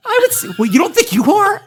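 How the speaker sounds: high and loud through laughter; laughing. Real, not acted. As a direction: laugh while asking. Teasing cohost, laughing.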